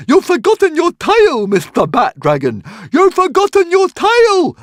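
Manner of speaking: high, loud squeaky shout; shouting Hogfly. Acted. Hogfly flapping after the dragon, shouting high and loud. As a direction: yell it after him as if he's deaf.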